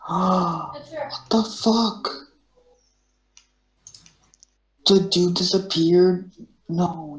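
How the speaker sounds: shocked disbelief. Real, not acted. Teammate vanished mid-game.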